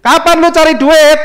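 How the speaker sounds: loud, high, drawn-out scolding; nagging wife. Retold or imitated. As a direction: nag like a fed-up wife. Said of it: "Pasti ngoceh kan?". (tiruan istri mengomel keras)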